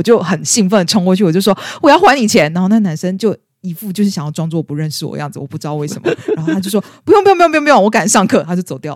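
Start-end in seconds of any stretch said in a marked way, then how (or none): 1.8-2.5 loud and high, eager to pay back; bursting out as you rush over; calling across the campus to repay him; excited call out. Retold or imitated. 7.0-8.4 high, loud rushed refusals; 同学急着推辞; hurried brush-off. Retold or imitated. Guy brushing her off, hurrying away.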